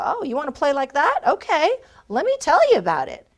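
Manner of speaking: mock delighted recruiter warming up; eager recruiter. Recruiter invited to talk about the company, eager. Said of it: "it's my turn to go".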